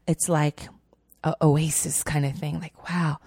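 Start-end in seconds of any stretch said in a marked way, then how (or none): none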